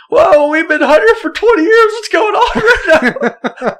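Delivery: high, loud plea through laughter; retold panicked yelling. Hunter to armed old landowner he knows, yelling in panic. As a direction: yell up high as if pleading not to be shot. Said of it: "We're yelling".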